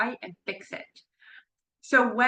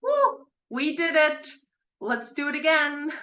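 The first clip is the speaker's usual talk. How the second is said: 1 excited bird voice; 6 semitones higher than usual. Retold or imitated. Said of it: "they're like".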